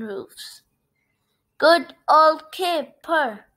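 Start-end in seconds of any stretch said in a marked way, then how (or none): 1.6-3.5 slow, raised cheer; hearty drawn-out praise. A narrator's reading. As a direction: cheer it out word by word, louder and higher. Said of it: "everyone said".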